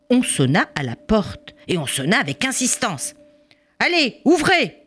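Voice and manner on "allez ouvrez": police shouting. Acted. Policeman at the door demanding entry, commanding shout. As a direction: bark the order through the door.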